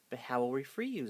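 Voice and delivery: plain reading voice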